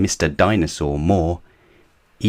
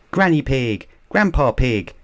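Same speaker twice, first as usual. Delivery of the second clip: higher, bright greeting call; cried; 7 semitones higher than usual. Acted. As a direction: call out happily to the grandparents. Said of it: "cried Peppa".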